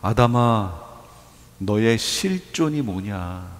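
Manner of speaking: low, soft and very slow solemn question; God questioning Adam. Acted. God asks Adam what he really is, low and slow. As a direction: ask gravely, weighing each word. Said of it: "하신 그 말씀의 의미가 뭐예요?".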